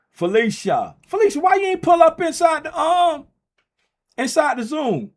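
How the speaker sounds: high, loud call across the room; hammed-up calling out to Felicia. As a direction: shout her name like she's hiding. Calling out to absent viewer.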